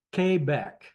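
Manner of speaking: Quebec said as kay-BECK; corrected pronunciation. Shown as a demonstration. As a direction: sound out "Quebec" with KAY at the start, no w. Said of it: "not Quebec".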